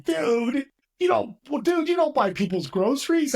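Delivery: put-on high-pitched California surfer kid; surfer dude. Cool young clerk to customer at Safeway, high surfer-dude voice. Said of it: "he's a surfer dude".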